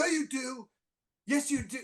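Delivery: very high, soft insistence; mock arguing back. Comedian silently contradicting the athlete he imitates, high soft protest. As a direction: argue back in a small high voice.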